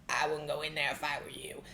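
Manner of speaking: low, ominous voice from the dark; menacing low drawl; slow ominous raspy drawl. Retold or imitated. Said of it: "I would hear that sound".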